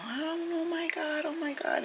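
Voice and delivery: high fretful exclaiming; flustered daughter. Retold or imitated. Daughter fretting over father's hurt foot, high and flustered. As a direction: mimic a panicked daughter. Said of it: "she just kept going".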